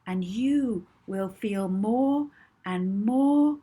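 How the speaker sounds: calm hypnotist counting backwards; soothing voice. Acted. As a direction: soothe her down as you count. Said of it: "her most soothing voice".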